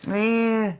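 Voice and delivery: high, exaggerated Long Island producer grunt; a nasal-free but clearly put-on New York "Eh"; Long Island accent. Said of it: "I'm trying to do his Long Island accent".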